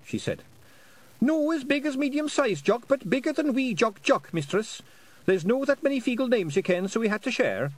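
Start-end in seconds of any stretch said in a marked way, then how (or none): 1.2-7.7 high squeaky Scottish pixie; tiny Scots warrior, chirpy and high; Feegle voice. Acted.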